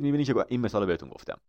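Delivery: usual speaking voice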